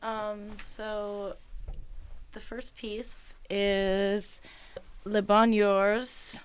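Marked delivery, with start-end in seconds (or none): 5.0-6.4 slow, careful attempt at French; sounding out the French title; French pronunciation.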